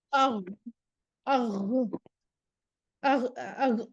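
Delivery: اغ with the voice flowing, not pressed off; ghain not choked. Shown as a demonstration. Teacher shows how the ghain should flow. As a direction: keep the sound running through the غ of اغ. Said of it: "ایسے نہیں ہم پڑھیں گے".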